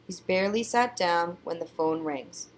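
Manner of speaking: ordinary delivery, nothing marked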